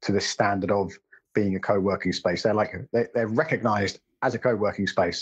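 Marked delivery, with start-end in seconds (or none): none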